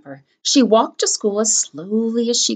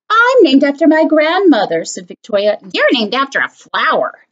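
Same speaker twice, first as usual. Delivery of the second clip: louder, haughty put-down; snooty sneer; 4 semitones higher, 5 dB louder than usual. Acted. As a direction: look down your nose at her. Victoria sneers at her.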